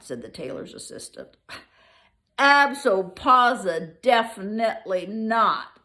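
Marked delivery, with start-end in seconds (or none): 2.4-5.8 loud, slow, drawn-out refusal; the third tailor tops the others with a loud no; emphatic refusal. Acted. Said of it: "said the tailor's assistant's assistant".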